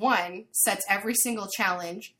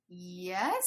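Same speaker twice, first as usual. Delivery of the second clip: small, soft yes with an uncertain lift; timid mock answer; 6 dB quieter than usual. Nervous contestant answering the stern chef, timid. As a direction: answer softly, unsure it is right.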